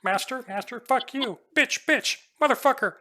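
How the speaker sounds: high, screeching insults; chattering apes turning on their master; angry baboon creatures. Acted. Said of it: "you hear a couple of them say".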